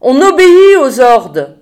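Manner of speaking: exasperated, pleading moan at full voice; moaned insistence. A narrator's reading. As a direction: wail it out, stretching the words. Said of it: "gémit Janie".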